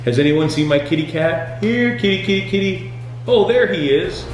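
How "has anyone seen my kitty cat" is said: ordinary talk, nothing marked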